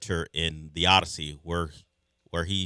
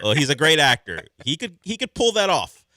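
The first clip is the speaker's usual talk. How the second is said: excited; 9 semitones higher, 7 dB louder than usual. Real, not acted. Enthusiastic defense of Pattinson.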